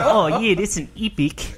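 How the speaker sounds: Kiwi "epic" said as eepic; lightly voiced New Zealand accent. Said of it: "what the difference is between saying epic".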